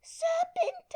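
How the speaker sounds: "Serpent" high, loud, shrieked in full voice; repeated accusation. A narrator's reading. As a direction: pigeon repeats "Serpent" loudly at Alice. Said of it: "repeated the pigeon".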